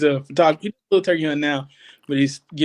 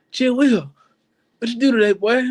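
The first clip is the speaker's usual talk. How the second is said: put-on higher, louder old-timer greeting; raised-voice granddad impression; imitating granddad; 7 semitones higher, 4 dB louder than usual. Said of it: "my granddad gets so mad".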